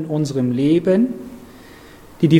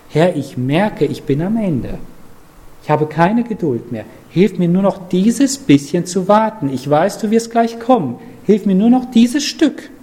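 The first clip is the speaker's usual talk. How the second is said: a plea spoken as a prayer, climbing higher toward the end; 3 semitones higher than usual. Shown as a demonstration. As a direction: pray it aloud like a begging sinner. Gebet vorgesprochen. (inständig gesprochenes Gebet, zum Ende höher)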